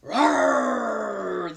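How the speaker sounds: loud drawn-out bear roar. Acted. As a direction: roar the answer like a hungry bear. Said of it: "the bear answered".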